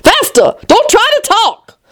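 high, urgent royal command; crying out. A narrator's reading. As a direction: cry it out, pitch jumping up. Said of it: "for she cried".

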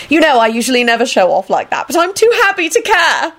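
high, giddy gush; giddy, climbing pitch; happy squeal. A narrator's reading. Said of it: "Elizabeth squealed happily".

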